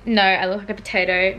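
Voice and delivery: ordinary talk, nothing marked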